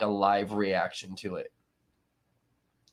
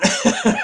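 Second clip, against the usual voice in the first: laugh; 10 semitones higher, 7 dB louder than usual. Real, not acted. Laughing at own Bob impression.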